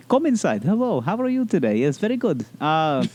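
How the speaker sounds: put-on high, friendly Russian doctor accent. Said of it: "he's a friendly Russian".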